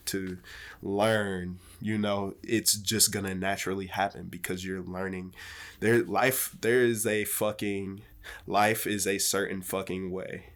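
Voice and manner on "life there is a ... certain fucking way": normal talking voice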